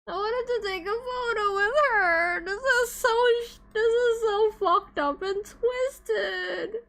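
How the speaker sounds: whiny fake sobbing, high and broken; mock crying. Real, not acted. Streamer wailing to chat over a missed photo, mock crying.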